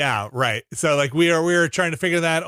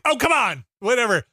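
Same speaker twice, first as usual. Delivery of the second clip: high, scoffing "oh, come on"; dismissive; 8 semitones higher than usual. Retold or imitated. Mocking family's weak palate, high scoff. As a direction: brush off the mild sauce. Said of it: "I'd be like".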